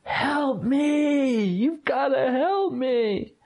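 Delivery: reenacting a cry for help in the storm; retold plea for help. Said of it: "crying to the heavens".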